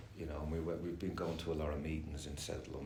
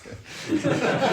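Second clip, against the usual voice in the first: loud laugh; 14 dB louder than usual. Real, not acted. Laughing loudly while telling a friend his troubles. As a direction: burst of high loud laughter.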